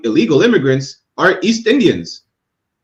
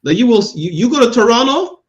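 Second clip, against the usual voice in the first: ordinary talk, nothing marked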